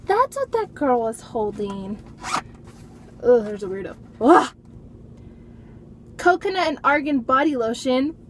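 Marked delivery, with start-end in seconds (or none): none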